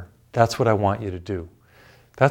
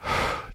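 a long, soft sigh out; deep sigh; 7 dB quieter than usual. Shown as a demonstration. Preacher showing the congregation a calming sigh. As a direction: let the breath go slowly. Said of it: "take a deep breath and".

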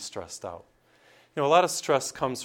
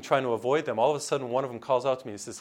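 usual speaking voice

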